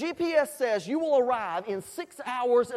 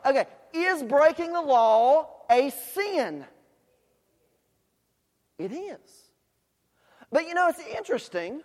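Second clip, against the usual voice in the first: ordinary talk, nothing marked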